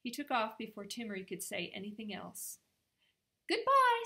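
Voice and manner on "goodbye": much higher and louder, a bright called farewell; polite goodbye. Acted. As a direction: call a polite goodbye up high as he flies. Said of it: "said Jack politely".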